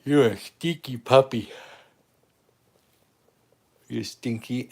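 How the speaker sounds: excited dog greeting. Real, not acted. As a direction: gush over the stinky puppy. Calling excitedly to the dogs.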